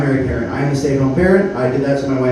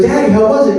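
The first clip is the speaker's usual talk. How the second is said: put-on higher, louder excited child asking; eager little kid; 8 semitones higher, 4 dB louder than usual. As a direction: ask it like a proud toddler. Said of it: "he goes".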